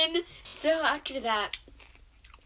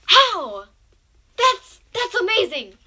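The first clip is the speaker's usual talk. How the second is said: high, loud cry of disbelief; a high squeal of amazed disbelief; shocked disbelief; 7 semitones higher, 12 dB louder, much slower than usual. Retold or imitated. Hears the shirt got wet.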